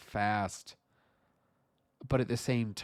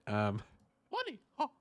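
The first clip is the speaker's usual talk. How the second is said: ordinary talk, nothing marked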